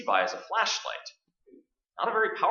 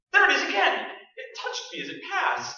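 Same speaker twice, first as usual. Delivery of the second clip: startled shout; 11 semitones higher than usual. Acted. Bat returns.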